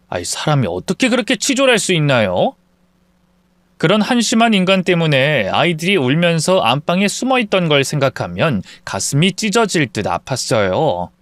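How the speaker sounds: ordinary delivery, nothing marked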